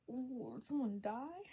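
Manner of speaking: drawn-out "did someone die?" rising at the end; slow mock-dread question; dramatic worried question. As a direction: stretch out "Oh, did someone die?" slowly, lifting the pitch.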